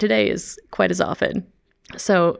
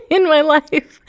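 laughing; 9 semitones higher, 8 dB louder, slower than usual. Real, not acted. Laughing as she admits to guests her French is rusty. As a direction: laugh bursting through the words.

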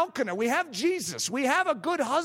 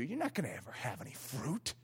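hushed, much softer taunting whisper; 11 semitones lower, 12 dB quieter than usual. Retold or imitated. Your own flesh mocking you in a soft whisper. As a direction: whisper the doubt low and close, like a sneer in the ear. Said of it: "It will whisper to you".

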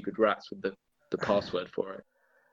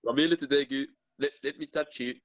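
put-on playful, slightly higher South African accent; 3 semitones higher, faster than usual. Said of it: "South African accent, please".

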